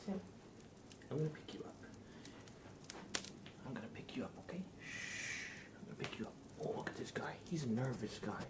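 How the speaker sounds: soft high whisper to a pet; whispering to the dog. Real, not acted. Streamer picks up her nervous dog, soft high whisper. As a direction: hush the scared dog.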